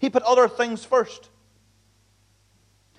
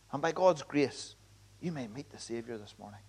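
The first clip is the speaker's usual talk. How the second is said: soft appeal; 7 semitones lower, 10 dB quieter than usual. Real, not acted. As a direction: let it fall gently as an invitation. Gentle closing appeal.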